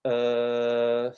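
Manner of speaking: "uh," held long and level; sustained hesitation vowel. Shown as a demonstration. Coach models a sound for everyone to copy, sustained vowel. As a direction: draw out a steady "uh,". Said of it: "make a sound similar to".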